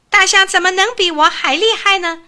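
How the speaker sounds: high, loud indignant cry; little mouse refusing to believe the elephant is stronger; displeased shout. A narrator's reading. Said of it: "很不高兴地喊了一声".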